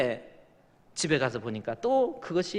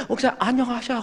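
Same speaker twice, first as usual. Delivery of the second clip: polite greeting; 7 semitones higher, 4 dB quieter, faster than usual. Retold or imitated. Polite greeting to pastor acted.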